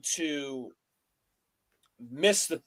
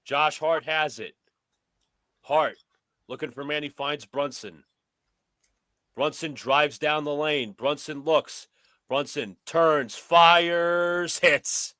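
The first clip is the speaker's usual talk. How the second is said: brisk sportscaster calling the action; play-by-play commentary; 2 semitones higher, 3 dB louder, slower than usual. Pretend. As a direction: call it like a courtside announcer. Plays announcer over the game.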